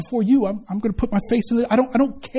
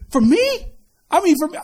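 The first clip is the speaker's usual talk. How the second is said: incredulous; 5 semitones higher than usual. Retold or imitated. Incredulous at God's favor.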